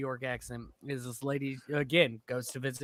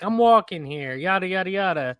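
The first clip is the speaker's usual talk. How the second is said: loud, higher mock New Yorker accent; New York accent cliche; 6 semitones higher, 8 dB louder than usual. Man imitating a tourist doing New York cliches, loud mock accent. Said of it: "doing the cliches".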